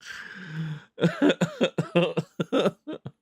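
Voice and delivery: high-pitched giggling laugh; long high giggle. Real, not acted. Laughing at own goldfish joke.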